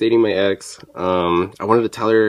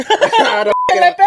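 loud laughing, much higher and slow; laughing tease; 6 dB louder, much slower than usual. Real, not acted. Teasing the crybaby nephew, laughing loudly.

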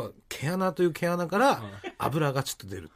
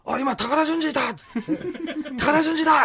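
excited mother; 11 semitones higher, 4 dB louder than usual. Retold or imitated.

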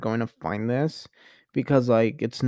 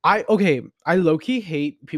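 usual speaking voice

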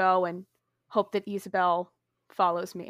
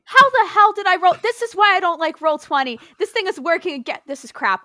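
loud, higher, fed-up complaint; frustrated rant; 8 semitones higher, 7 dB louder than usual. Real, not acted. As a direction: vent at the dice. Player rages at dice roller.

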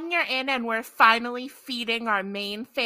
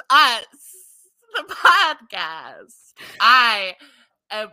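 vampire voice; 9 dB louder, slower than usual. Pretend. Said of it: "why am I got into vampire voice?".

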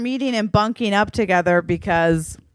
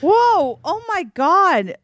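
shocked; 9 semitones higher, slower than usual. Real, not acted. Hearing mom is leaving the farm, shocked. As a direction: react in stunned disbelief.

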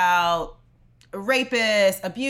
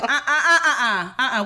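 mock scolding uh-uhs; imitated strong objection; 4 semitones higher, 4 dB louder, faster than usual. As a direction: cut in like an aunt objecting, amused. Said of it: "Aunt Viv came strong".

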